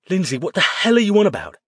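loud, high and fast; alarmed demand. A narrator's reading. Man pinning his possessed girlfriend down, loud and fast. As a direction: fire the question at her in disbelief.

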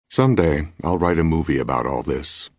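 plain reading voice

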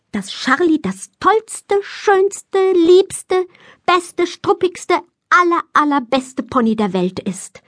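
high, item-by-item superlatives; high, insistent child's gushing list; gushing demand. A narrator's reading. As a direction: dictate each praise word with rising fervour. Child makes Mama praise her pony.

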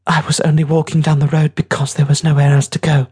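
put-on high, defensive boy voice; young boy, defensive. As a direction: justify yourself like a stung kid.

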